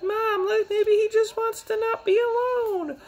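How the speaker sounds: very high falsetto girl imitation; mock girly voice. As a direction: squeak it like a pleading young girl.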